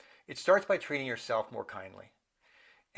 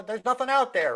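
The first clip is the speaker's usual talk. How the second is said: high, raised exasperated call; mock yelling; 8 semitones higher, 4 dB louder than usual. Owner calling to a dog barking at nothing, mock yell. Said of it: "they yell out their window".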